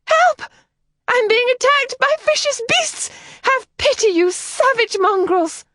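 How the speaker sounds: shrill, loud playacted cry for help, slowing at the end; a girl hamming up terror for fun; high-pitched mock scream. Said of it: "interrupted by a high-pitched scream".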